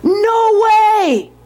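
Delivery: high, drawn-out refusal; emphatic disbelief. A narrator's reading. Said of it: "shook her head slowly and said".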